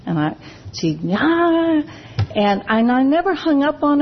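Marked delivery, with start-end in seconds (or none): 1.1-1.8 higher, mock exasperated ah; a quick put-on cry of frustration; frustrated cry. Imitating the angry sponsee as she hangs up, mock exasperated cry.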